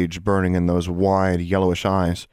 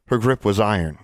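ordinary delivery

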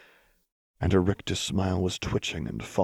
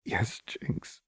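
breath-only tearful whisper; soft sobbing; 4 semitones higher, 6 dB quieter than usual. A narrator's reading. Lux answering a frightened Jinx, soft sobbing whisper. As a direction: whisper it softly through tears. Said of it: "Lux sobbed softly".

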